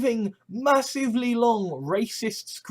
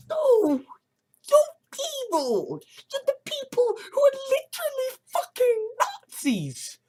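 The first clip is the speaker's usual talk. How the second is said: much higher, strained exasperation; exasperated squeak. Real, not acted. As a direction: squeal the outrage up high. Exasperated outburst at the Nazis.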